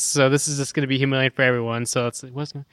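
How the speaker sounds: ordinary talk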